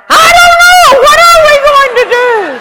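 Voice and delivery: high, loud, shrill-voiced comic panic; mock panic. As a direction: mirror her terror right back at her. Narrator mimics her own panic.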